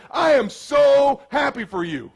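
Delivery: high, loud, slow joyful cry; hammed-up celebration call; happy shout. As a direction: burst with joy for a friend. Calling friend to celebrate.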